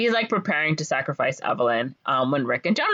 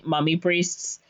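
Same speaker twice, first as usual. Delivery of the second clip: ordinary talk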